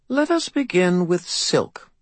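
high, slow, disdainful fey lady; fairy godmother voice. A narrator's reading. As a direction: look down your nose at him. Leah starts the spell.

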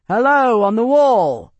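loud hail up to the wall; calling out to sentries on a distant wall. Acted. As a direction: shout up to the guards on the wall. Hailing guards on a wall.

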